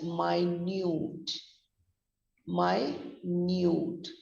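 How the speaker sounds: "minute" as my-NYOOT, drawn out slowly; adjective pronunciation. Shown as a demonstration. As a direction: stretch "minute" into two long syllables, "my" and "nyoot". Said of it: "It's pronounced as minute".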